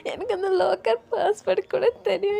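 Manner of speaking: tearful, breaking pleading; crying. Acted. Oyan begs Jesi through tears to take her to JK. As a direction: beg through tears to be taken to him. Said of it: "wine, அழுவாத".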